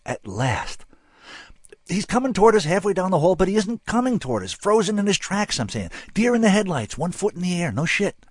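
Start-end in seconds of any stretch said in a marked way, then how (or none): 1.9-8.2 high-pitched, agitated blurted account; Frank spilling it. A narrator's reading. Frank confessing to his interrogators what he saw, high and agitated. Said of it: "Frank just started spilling".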